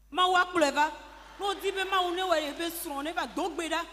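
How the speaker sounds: rally the listeners like a preacher at full force; loud exhortation. Real, not acted. Preacher urging the congregation to pray for a husband, loud exhortation. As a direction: preach it out loud and strong, pressing each word.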